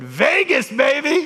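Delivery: high, loud playful whoop; gleeful cheer. Retold or imitated. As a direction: toss it out with a big grin. Grinning patient names her dream trip.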